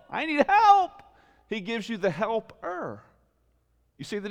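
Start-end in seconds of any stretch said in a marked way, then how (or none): 0.1-1.0 mock plea for help. Voicing a person asking God.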